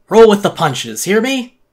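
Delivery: loud, high, scolding mentor figure; stern lecturing voice. Acted. Creature scolding the narrator to grow up, loud and stern. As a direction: lay down the law, pushing hard.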